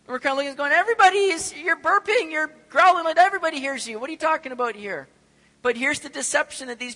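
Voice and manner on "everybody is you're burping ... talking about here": loud, high, disbelieving shout; exasperated retort. Retold or imitated. Preacher answering the wicked's boast, loud exasperated shout. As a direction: throw it back at them, amazed they ask.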